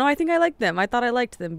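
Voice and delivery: ordinary delivery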